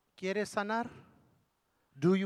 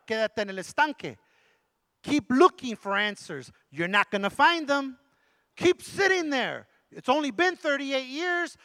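sarcastic taunt; 8 semitones higher, 5 dB louder, slower than usual. Acted. Mocking the man at the pool.

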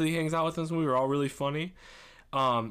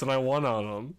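ordinary talk, nothing marked

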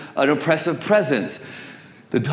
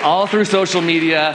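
usual speaking voice

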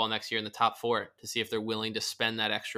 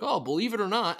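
high, breezy throwaway tone; mocking casual use; 10 semitones higher, 4 dB louder than usual. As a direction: toss it off like a cliché.

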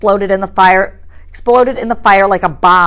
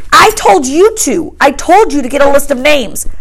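higher, louder accusing; angry Ralph; 6 semitones higher, 4 dB louder than usual. A narrator's reading. Ralph blaming Piggy for the missing list, angry. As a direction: pin the blame on him.

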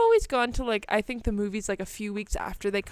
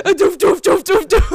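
put-on kid slapping noises; 8 semitones higher, 13 dB louder than usual.

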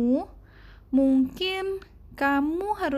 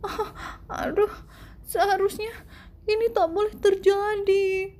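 put-on much higher, panicky child voice; panicking; 7 semitones higher than usual. A narrator's reading. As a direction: fret as the hat unravels. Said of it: "Douglas panik".

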